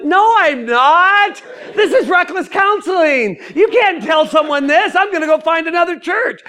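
high, drawn-out indignant rant; hammed-up outraged realization; getting upset. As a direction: rant up high in mock outrage, stretching the key words. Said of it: "Now I'm getting a little upset".

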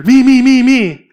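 high, loud, overeager hand-waving cry; pushy kid desperate for a turn; eager me me me. Retold or imitated. [name] eager to speak in Bible study, loud overeager cry. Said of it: "I was like Peter".

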